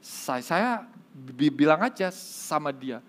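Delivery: halting stutter, repeating every first sound; a shy stutterer struggles to get the words out; stuttering man. Acted. Nervous stutterer talks to the pastor, stuttering hard. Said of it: "orang gagap ini bilang".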